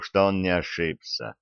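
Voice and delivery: usual speaking voice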